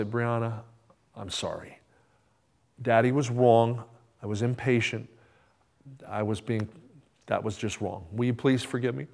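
quiet, contrite father; contrite apology. Retold or imitated. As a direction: humbly ask a child's forgiveness. Father apologises to crying daughter.

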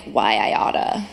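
put-on low, slow old-timey tough guy; gruff tough guy. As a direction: growl it like a cartoon brawler. Cold War feud acted out.

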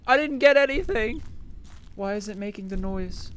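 high-pitched, indignant complaint; worked-up gamer whine; exasperated protest. Real, not acted. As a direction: plead and complain at an unfair game. Complaining at the rigged game.

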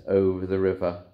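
plain reading voice

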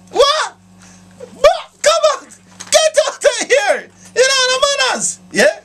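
put-on very high, slow scolding old woman; shrill shocked grandmother telling him off; high, slow mock scolding from a gran; outraged mother.

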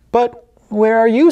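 put-on a small boy worried he's alone; little boy voice. Little boy asking mom where she is, childlike.

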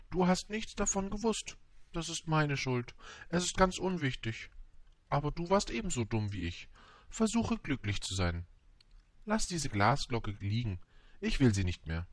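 high flower voice easing down by the end; high storybook flower bidding farewell; proud flower, resigned. A narrator's reading. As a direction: start high, release him as you descend.

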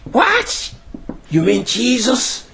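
high-pitched, acted astonishment; amazed disbelief. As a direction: act out thrilled amazement, voice leaping up. Blind man learns Jesus is near.

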